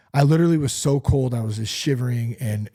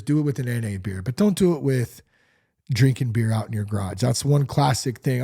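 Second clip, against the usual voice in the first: ordinary talk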